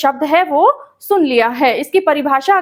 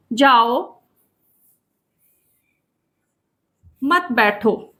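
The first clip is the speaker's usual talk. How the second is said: lower-pitched "जाओ", long pause, then "मत बैठो"; pause after जाओ; 4 semitones lower, much slower than usual. Shown as a demonstration. Teacher reading written example to students, pausing after "जाओ". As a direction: split it after "जाओ", keep "मत बैठो" together. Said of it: "coma पर निर्भर है कि हमें क्या करना है".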